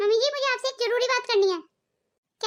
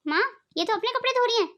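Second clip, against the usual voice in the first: ordinary delivery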